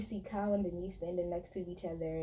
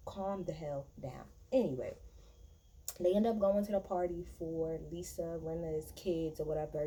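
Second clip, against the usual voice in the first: usual speaking voice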